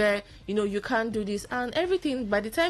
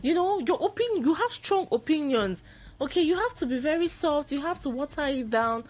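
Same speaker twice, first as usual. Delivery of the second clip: higher, mock-scolding lecture; mock parental lecture; 7 semitones higher than usual. Woman imitating parents telling outspoken daughter to tone down, mock scolding. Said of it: "they'll be like".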